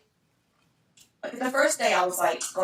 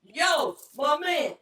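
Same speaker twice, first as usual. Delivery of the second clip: mock angry; 8 semitones higher, much slower than usual. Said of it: "No, you didn't".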